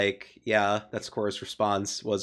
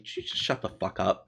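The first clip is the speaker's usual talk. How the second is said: softer deadpan dismissal; dry sardonic; 4 semitones lower, 4 dB quieter than usual. Shown as a demonstration. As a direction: say it bored and dry. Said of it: "somebody dryly and sardonically".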